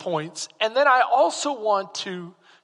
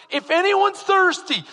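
yelling out; 10 semitones higher, 6 dB louder than usual. Pretend. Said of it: "he's yelling out".

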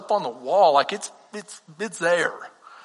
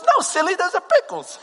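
high New York deli lady, teasing; New York accent; 9 semitones higher than usual. Retold or imitated. Deli lady correcting a clueless customer, high New York accent. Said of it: "in this New York accent".

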